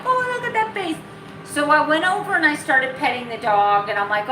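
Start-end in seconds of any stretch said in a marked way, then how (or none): 0.0-1.0 put-on high dog baby talk.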